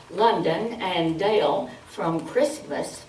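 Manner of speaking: usual speaking voice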